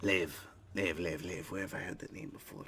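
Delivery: muttering. Acted. God muttering to himself.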